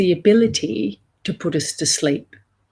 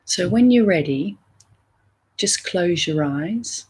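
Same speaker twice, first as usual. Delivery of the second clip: guided meditation. Acted. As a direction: gently lead listeners inward, leaving room between phrases.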